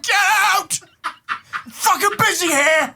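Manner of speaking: put-on dad yelling at kids who walk in, laughing shout; mock yell. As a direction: shoo the kids out, half laughing.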